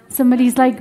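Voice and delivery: ordinary talk, nothing marked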